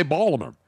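Baltimore slurred to "Bawlamer"; local pronunciation. Shown as a demonstration. Co-host shows how locals say it, Baltimore as "Bawlamer". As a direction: drop the T in Baltimore, "Bawl-uh-mer". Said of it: "they say Baltimore".

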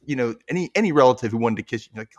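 usual speaking voice

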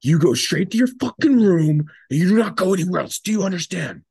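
loud, high barked order; furious sergeant voice; mock drill sergeant yell. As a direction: bellow it like an angry sergeant. Sergeant yelling at him.

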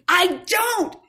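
high, loud whiny denial; stubborn childish no; bickering voices. Retold or imitated. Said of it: "had this argument with myself".

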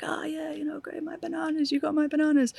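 high, put-on little old man's voice. Little old Liberian man greeting the pilots, imitated high voice. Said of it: "this little old Liberian man".

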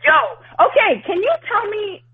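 high, excited and rambling; stoned and worked up. Real, not acted. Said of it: "sorry, I'm very high".

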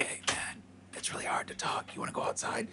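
unvoiced whisper to a friend. Telling his friend at the party to step outside, whispered. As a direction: whisper it so nobody else hears.

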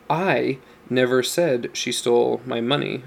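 long, slow "I" set apart at the start; stress on I. Shown as a demonstration. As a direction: lean on "I", drawn out slowly, then the rest plain. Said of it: "depending on the emphasis you give".

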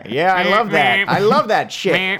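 ordinary talk

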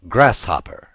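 usual speaking voice

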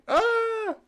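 high mock scream. Voicing the scared medic with a high mock scream. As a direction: yelp like a panicked medic.

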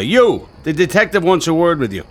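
loud high call across the park. Acted. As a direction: summon the neighbor sharply. Sergeant calling witness over.

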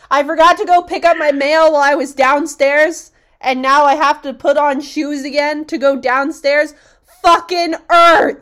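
loud, high, fast complaint ending in a yell; mock rant shout. Fed up with forgotten credit card, loud ranting yell. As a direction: rant then blow up. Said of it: "it'll be like".

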